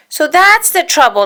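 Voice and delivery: high, loud angry realisation; indignant cry. A narrator's reading. Dale discovering Donald's trick, high and loud. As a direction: catch the trick out. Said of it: "Dale cried".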